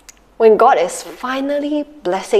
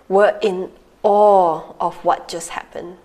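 ordinary talk

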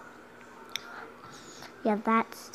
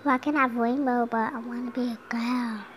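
usual speaking voice

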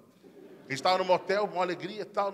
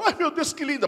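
higher moved gasp of delight; touched wife; 9 semitones higher than usual. Acted. Wife moved by her kids' gifts.

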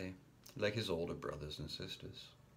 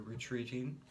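usual speaking voice

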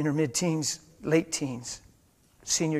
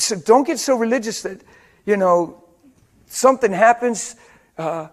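loud, higher, insistent preacher's voice; preaching at full force; 7 semitones higher, 12 dB louder than usual. Acted. Pastor warning the church through a car crash story, loud. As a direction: press the warning on the congregation.

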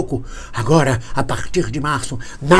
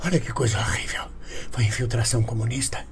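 whisper; 4 semitones lower, 11 dB quieter than usual. Pretend. Mock-horrified aside after chant.

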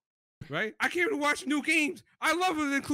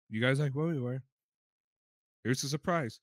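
low smug Nintendo; 11 semitones lower than usual. Retold or imitated. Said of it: "they're just like".

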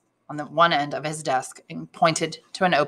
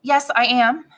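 high, mildly flustered reply; understated stammer, higher in pitch; 7 semitones higher, 4 dB louder, slower than usual. A narrator's reading. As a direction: answer a stranger nervously, voice pitched up. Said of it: "stammered Julie".

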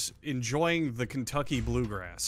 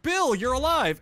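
much higher, louder astonished cry; mock shock; 12 semitones higher, 7 dB louder than usual. Movie friends stunned to find Bill at the door, astonished. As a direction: gasp it like seeing a ghost.

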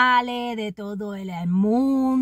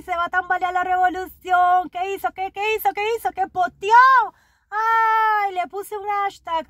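squeaky falsetto, much higher, panicky whimper; mock scared official; 10 semitones higher than usual. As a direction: play a cowardly bureaucrat terrified of a phone. Mimics scared regime officials. (funcionario asustado en falsete chillón, mucho más agudo)

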